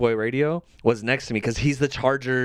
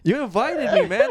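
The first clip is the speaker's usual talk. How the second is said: high, pleading mock hurt; exaggerated wounded whine, pitched way up; imitated sad protest; 13 semitones higher than usual. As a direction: act out the hurt friend. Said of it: "he sounded so sad when he said it".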